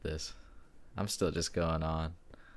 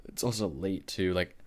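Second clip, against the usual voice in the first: ordinary talk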